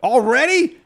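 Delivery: a high, disbelieving call that jumps up on Already; retold yell. Fan yelling from the bathroom at the game, retold yell. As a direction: call out in mock shock from another room. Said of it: "I yell from the bathroom".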